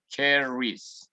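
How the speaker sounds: "Cherries" said in English, ending in "-ies"; English demo of "Cherries"; English pronunciation. Shown as a demonstration. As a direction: model "Cherries" for the class. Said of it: "Cambiamos la Y por la I E S".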